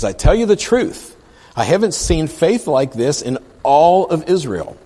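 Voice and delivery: high, loud declamation; proclaiming scripture. Acted. Jesus speaks to the crowd about faith, loud proclaiming.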